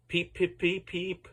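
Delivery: high whistled engine peeps; whistle peep. A narrator's reading. Edward whistling to James, high peeping whistle.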